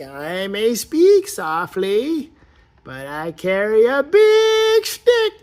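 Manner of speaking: lightly hammed-up royal proverb; slow mock king. King toy boasting to the viewer, slow hammed-up royal voice. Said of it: "He's like".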